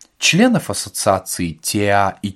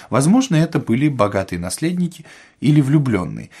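ordinary delivery, nothing marked

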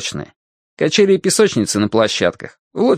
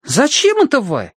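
high-pitched surprised question; puzzled boy piping up; 12 semitones higher than usual. A narrator's reading. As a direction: ask it in a high, astonished boy's voice. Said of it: "громко удивился Тенька".

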